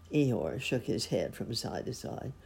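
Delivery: ordinary delivery, nothing marked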